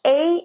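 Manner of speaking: "A" as a gliding ay sound; long A sound. Shown as a demonstration. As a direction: say "A" as the letter name, like "ay". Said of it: "let's say if you take letter A".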